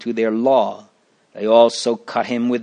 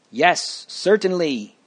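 higher-pitched, understated exclamation; mild cry; 6 semitones higher than usual. A narrator's reading. Juror agreeing with the verdict, mild cry. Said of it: "Mister Malice cried".

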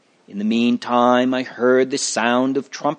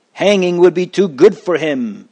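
louder, higher-pitched condemnation; restrained yell; 6 semitones higher, 5 dB louder than usual. A narrator's reading. Cruel juror condemning the accused, restrained yell. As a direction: yell it out, short of a full shout. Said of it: "Mister Cruelty yelled".

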